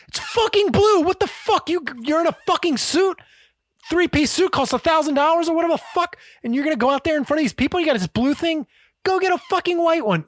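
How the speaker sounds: high-pitched, rapid mock rant; Gordon Ramsay. Chef rants at waiter over blue plaster, high mock fury. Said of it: "losing his shit, right".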